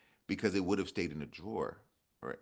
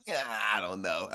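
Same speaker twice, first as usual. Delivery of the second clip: slow, drawn-out mock doubt; petty hesitation; 2 semitones higher, 3 dB louder, slower than usual. Author joking about refusing publishers, slow petty doubt. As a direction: stall like you're playing hard to get. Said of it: "a little part of me that's like".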